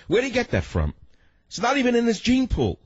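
high-pitched indignant disbelief; incredulous outrage. Real, not acted. Host disputing a politician's claim, high-pitched incredulous outrage. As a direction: challenge it, pitch shooting up in disbelief.

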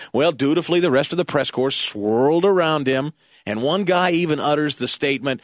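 ordinary delivery

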